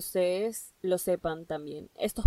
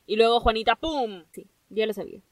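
ordinary talk

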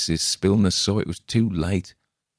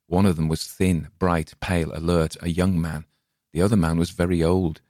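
ordinary delivery

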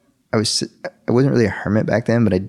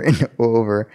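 normal talking voice

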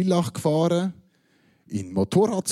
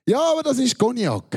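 cheeky excuse; 9 semitones higher than usual. Retold or imitated. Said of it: "hat er gesagt".

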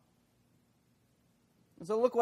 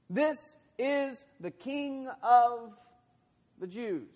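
slow, high sarcastic reading; 7 semitones higher, 3 dB louder, slower than usual. Retold or imitated. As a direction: read the sign with a sneer. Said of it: "It was a sarcastic, nudge".

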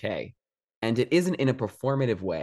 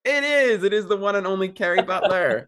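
excited welcome; 9 semitones higher, 6 dB louder than usual. Real, not acted. Excited greeting of a guest.

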